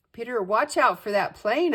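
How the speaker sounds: yell. A narrator's reading. Girl yelling a warning to her brother. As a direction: yell it to warn him. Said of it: "I yelled".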